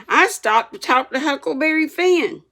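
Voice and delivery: put-on high, loud boyish voice; boy answering his teacher brazenly; boyish bold reply. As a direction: blurt the truth out boldly. Tom's bold confession.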